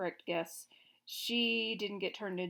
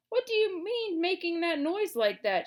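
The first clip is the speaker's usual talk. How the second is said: higher, louder angry king; angry shout; 9 semitones higher, 7 dB louder than usual. A narrator's reading. Gnome King shouting angrily at the hen. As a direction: bark it at the hen. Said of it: "shouted the king angrily".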